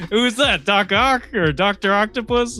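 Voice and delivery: high, puzzled mock-dad voice; imitating clueless dad. Host mimics his dad naming a villain, high and puzzled. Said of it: "see a guy with goggles ... and say".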